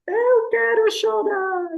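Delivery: put-on high, slow whimpering little-girl voice; whiny granddaughter. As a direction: imitate a child who wants to cry. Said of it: "minha neta diz assim". (voz aguda e arrastada de criança manhosa querendo chorar)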